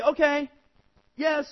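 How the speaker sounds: a breezy yes he won't keep; kid giving lip service. Pretend. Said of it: "You might say".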